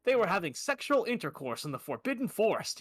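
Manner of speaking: normal talking voice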